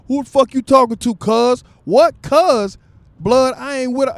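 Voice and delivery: loud, high street yelling; gang fight shouting. Retold or imitated. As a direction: yell it like a gang member squaring up. Men's gang fight acted.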